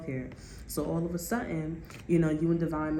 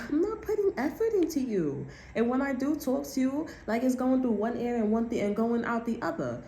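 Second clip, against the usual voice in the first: mocking a dismissive girlfriend; 7 semitones higher than usual. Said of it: "and the bitch is like".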